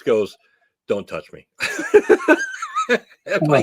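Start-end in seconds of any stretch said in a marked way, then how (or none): 0.9-1.5 low, quiet deadpan; deadpan serious. Retold or imitated. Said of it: "straight face". 1.6-3.0 squealing laugh; high burst of laughter. Real, not acted. Laughing at the wrestling bit.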